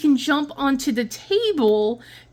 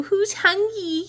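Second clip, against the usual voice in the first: high call to pets; 8 semitones higher, slower than usual. Acted. Calling cats to food.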